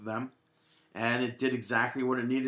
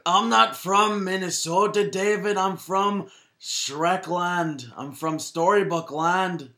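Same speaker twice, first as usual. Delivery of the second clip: higher, louder, slow hammed-up Shrek; 7 semitones higher, 5 dB louder, slower than usual. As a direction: play Shrek insisting on his swamp home. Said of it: "that's like Shrek".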